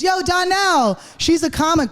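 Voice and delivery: retold calling out. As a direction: hail a friend across the room, retold. Retold man calling Donnell over.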